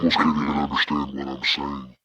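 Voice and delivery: low electronic monster voice modulation. Shown as a demonstration. Demonstrating the last voice modulation, low monster voice. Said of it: "It's the monster voice".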